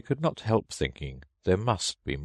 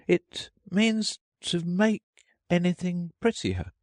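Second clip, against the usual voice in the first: doubtful; 13 semitones higher than usual. A narrator's reading. Alice defining the word for the Gryphon, doubtfully. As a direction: feel your way to a definition, pausing. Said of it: "said Alice doubtfully".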